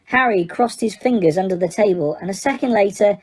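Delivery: ordinary delivery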